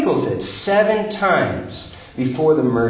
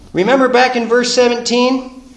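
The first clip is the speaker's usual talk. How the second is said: louder and higher, driving each line home; preaching at full force; 10 semitones higher, 5 dB louder than usual. Acted. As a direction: preach it like the climax of the sermon.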